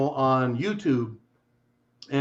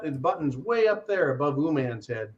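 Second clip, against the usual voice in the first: ordinary talk, nothing marked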